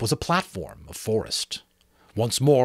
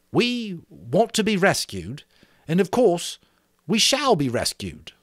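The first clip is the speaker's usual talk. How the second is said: lightly voiced boy leader, higher and bright with an idea; rally the group with the plan; a boy chief sharing his plan with the assembly, eager; Ralph, eager and earnest; 8 semitones higher than usual. Ralph reassures the boys.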